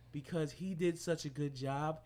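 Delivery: ordinary talk, nothing marked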